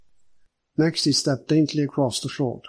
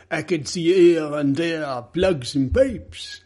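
slow, measured detective, pitched up; detective's character voice; 4 semitones higher, 4 dB louder, slower than usual. Acted. Detective surveying the burnt premises, slow character voice. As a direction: voice a detective slowly reporting what he saw.

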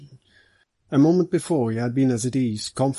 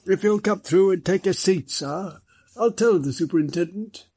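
higher-pitched constable, voice slightly raised; 7 semitones higher than usual. A narrator's reading. Constable inviting a visitor through to wait, raised voice. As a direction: call it through from the outer office. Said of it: "raised his voice".

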